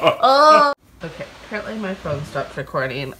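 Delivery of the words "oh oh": loud squeal. Real, not acted. Tickled squealing.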